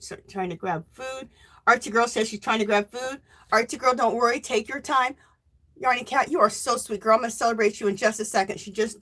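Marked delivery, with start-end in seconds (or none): none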